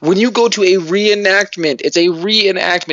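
high, insistent lecturing; uppity. Real, not acted. Host schooling fantasy fans on reenactment rules, worked up. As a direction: hammer the point, all riled up. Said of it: "No, I'm uppity".

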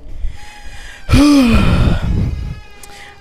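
loud, long huff; exasperated sigh. Real, not acted. Fed up that the recording keeps pausing, loud exasperated sigh. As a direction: let out a big frustrated sigh.

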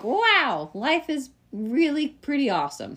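high-pitched, wide-eyed wonder; acting out a delighted epiphany; wowed realization. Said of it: "and then you're like".

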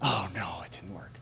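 mock defeat, barely voiced; mock despairing whisper. Shown as a demonstration. The preacher mocking a defeated believer, low whisper. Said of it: "we don't say".

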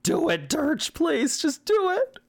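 high, strained begging through pain; pained pleading. Acted. Vanya begging Dirch to shoot her, in agony. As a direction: beg him to end it, voice cracking high.

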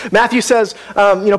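ordinary talk

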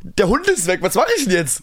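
nachgespielter panischer Gedanke, deutlich höher; gespielte Panik. Retold or imitated. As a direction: wie ein aufgeschreckter Hundesitter, mit hoher Stimme. Spielt seinen panischen Gedanken nach. (gespielt panisch, hohe Stimme, aufgeregt)

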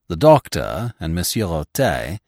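ordinary delivery

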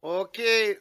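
ordinary talk

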